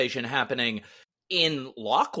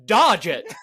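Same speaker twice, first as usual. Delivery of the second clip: laughing mock shout of a simple command, louder; mock trainer command; 5 semitones higher, 8 dB louder than usual. Mocking anime trainers, a laughing louder mock yell. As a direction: yell it like a cartoon trainer while cracking up. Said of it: "And I was thinking, you're sitting there saying".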